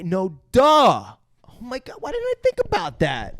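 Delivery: high-pitched acted astonishment; mock sudden realization. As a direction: exclaim it as if it just dawned. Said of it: "And it's like".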